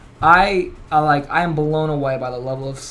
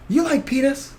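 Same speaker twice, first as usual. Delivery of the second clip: provocative Tony; 10 semitones higher, slower than usual. Retold or imitated. Tony provoking a lady on stage.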